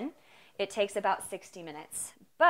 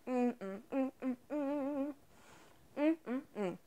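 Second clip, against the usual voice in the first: savoring mmm; 4 semitones higher, 4 dB quieter than usual. Real, not acted. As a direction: hum with delight at each bite. Tasting bread.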